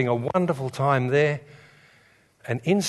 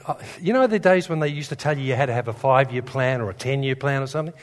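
ordinary talk, nothing marked

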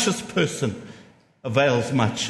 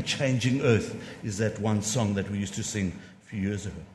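ordinary delivery

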